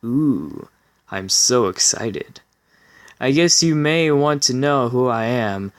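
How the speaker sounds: ordinary talk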